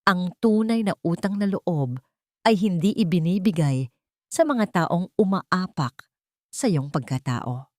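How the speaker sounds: plain reading voice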